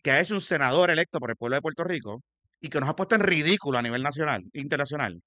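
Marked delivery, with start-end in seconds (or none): 0.0-3.8 condena enérgica, más fuerte y aguda; indignado. Real, not acted. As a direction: recalca cada acusación con indignación real. Exige censura al senador electo. (indignado, alzando la voz)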